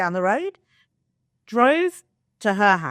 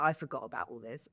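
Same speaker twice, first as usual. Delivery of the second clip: quiet; 6 semitones lower, 12 dB quieter, faster than usual. Retold or imitated. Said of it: "I was like".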